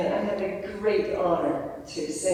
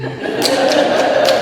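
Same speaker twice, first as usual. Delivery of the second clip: breathy burst of laughter; 11 dB louder than usual. Real, not acted. Singer laughing after imitating Monica's voice. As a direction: laugh at your own impression.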